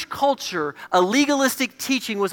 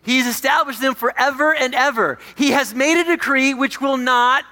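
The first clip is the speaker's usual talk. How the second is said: loud, high scripture declaration; raised, resounding reading of the verse; emphatic proclamation; 5 semitones higher, 5 dB louder than usual. Acted. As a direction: proclaim the decree with full force.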